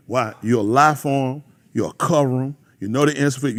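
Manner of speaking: usual speaking voice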